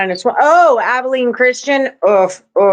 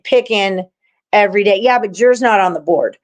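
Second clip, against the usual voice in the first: ordinary talk, nothing marked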